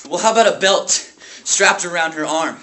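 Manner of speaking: loud, angry challenge; bitter outburst. Acted. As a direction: burst out of grief into anger. Grieving brother lashes out bitterly.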